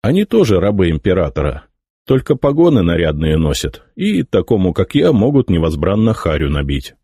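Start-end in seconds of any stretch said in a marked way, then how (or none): none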